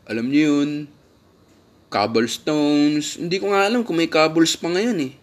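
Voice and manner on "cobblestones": slow drawn-out. Real, not acted. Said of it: "repeats word".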